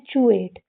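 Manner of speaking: "चू एट" opening on a ch sound; correct ending pronunciation. Shown as a demonstration. Teacher answering her own question for kids, "चू एट" with ch. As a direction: say "चू एट", chew plus ate. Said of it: "हम इसको क्या पढ़ते हैं".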